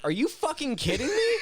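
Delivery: high, loud mock disbelief with a laugh; laughing, outraged re-enactment; retold outrage. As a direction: re-enact being incredulous, voice shooting up. Retold disbelief at friend's apology.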